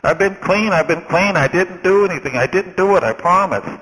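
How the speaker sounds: high, loud, frantic protest of innocence; panicked pleading. Retold or imitated. Ex-forger to policeman at his door, panicked high pleading. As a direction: beg the cop to believe you. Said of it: "The guy started shaking".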